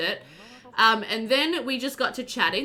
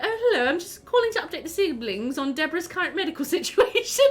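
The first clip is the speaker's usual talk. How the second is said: high, formal phone-call voice, breaking into laughter; mock formal update, pitched up; overly proper caller; 7 semitones higher, faster than usual. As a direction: imitate yourself being stiffly proper. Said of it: "And there's me like".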